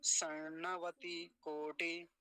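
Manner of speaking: very slow शर्णवती कोटि, nasal held; careful retroflex model. Shown as a demonstration. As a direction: separate every syllable of शर्णवती कोटि. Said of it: "उसके लिए सावधानी रखनी होगी".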